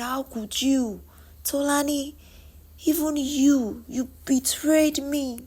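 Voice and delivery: high, plaintive reproach; hurt, betrayed. Acted. Ego confronting her best friend's betrayal, wounded. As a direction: plead in a high pitch, slowing and softening as the hurt sinks in.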